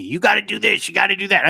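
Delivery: a hint of a pushy boss shouting; mock yelling Blake. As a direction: imitate a bossy producer barking orders. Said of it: "Blake's yelling at me".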